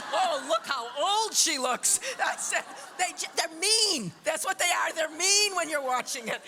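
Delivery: high, loud indignant laughing rant; mock outrage. To therapist, indignant and half-laughing about mean viewers. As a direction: whine at the cruel critics. Mocking mean audience voices.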